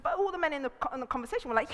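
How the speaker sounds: ordinary talk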